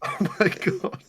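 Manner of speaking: words dissolving into laughs; helpless laughter. Real, not acted. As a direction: say it while cracking up. Cracking up at co-host.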